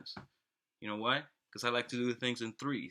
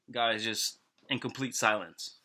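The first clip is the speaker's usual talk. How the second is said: ordinary talk, nothing marked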